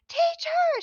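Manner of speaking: put-on high, loud little-girl call; child's yell. Little girl calls to get teacher's attention, imitated yell. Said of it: "She's yelling at me".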